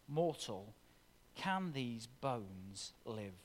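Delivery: soft personal question. Acted. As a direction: let it sink in, almost under your breath. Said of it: "repeated to the listeners".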